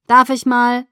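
loud, high, curt request; brusque. A narrator's reading. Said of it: "sagte sie schroff".

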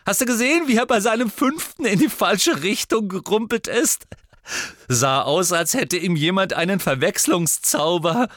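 put-on high-pitched, loud, animated boy; gleeful gloating. A narrator's reading. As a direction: recount it with loud, gloating glee. Ron gloats over rival's miss.

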